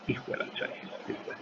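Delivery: muffled whispered oath; whispered curse. Real, not acted. Teacher cursing to himself in a whisper. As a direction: mutter it under your breath.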